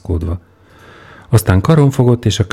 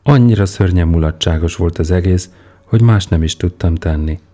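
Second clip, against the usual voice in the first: plain reading voice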